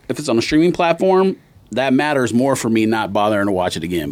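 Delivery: ordinary talk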